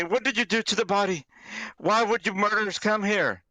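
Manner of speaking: alarmed outcry. Acted. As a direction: cry out in shock at the killers.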